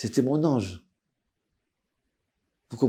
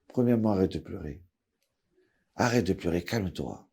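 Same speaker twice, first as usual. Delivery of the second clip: low, softer, reassuring voice; calming Rav; 8 semitones lower, 11 dB quieter than usual. Retold or imitated. Rav calms the crying father on the phone, soft and low. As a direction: settle him down gently.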